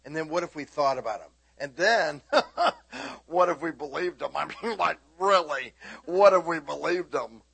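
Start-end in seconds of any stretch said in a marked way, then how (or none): 1.6-7.4 loud, rising preacher's push; fiery preaching. Acted. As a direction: drive it home to the congregation. Preaching at full force.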